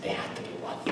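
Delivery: breathy retold whisper. Pastor retelling his hushed reply to Mary. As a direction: hush it like a confidence.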